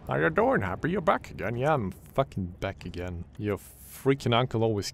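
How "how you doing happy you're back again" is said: mock fish greeting.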